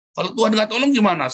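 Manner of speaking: high, loud protesting question; retold shout. Preacher re-enacts shouting at his wife, retold shout. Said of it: "Saya teriak begitu sama istri saya".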